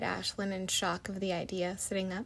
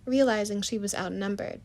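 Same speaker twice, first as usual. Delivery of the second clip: ordinary delivery, nothing marked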